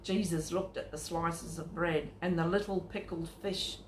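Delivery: usual speaking voice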